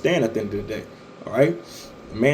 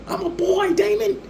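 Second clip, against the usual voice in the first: very high falsetto yelp, far from his own voice; Damon's squeal. Retold or imitated. Mimicking Damon screaming while his nuts are clenched, falsetto squeal. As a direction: squeak it out like a panicked grown man.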